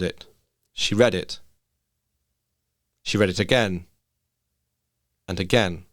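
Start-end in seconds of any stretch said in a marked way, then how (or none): none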